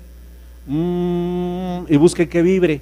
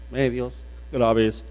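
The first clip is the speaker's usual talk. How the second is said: slow, lower voice; 5 semitones lower, 3 dB quieter, much slower than usual. Shown as a demonstration. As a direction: say graves deep and slow. (grave y lento)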